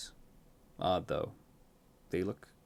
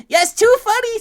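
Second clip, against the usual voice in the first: very high, loud squealing twin; maniacal glee; 18 dB louder than usual. A narrator's reading. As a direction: shriek agreement with mad delight. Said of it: "Pip and Squeak laughing maniacally".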